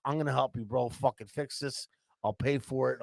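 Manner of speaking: calm him down in a softened voice; placating. Retold or imitated. Culprit promising neighbour he will pay, soft placating manner.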